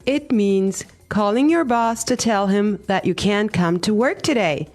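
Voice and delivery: ordinary talk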